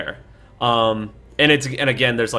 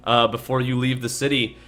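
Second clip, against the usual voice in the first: usual speaking voice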